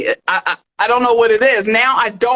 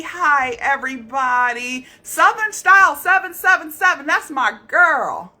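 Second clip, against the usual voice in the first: ordinary talk, nothing marked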